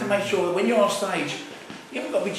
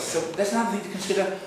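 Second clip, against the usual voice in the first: ordinary delivery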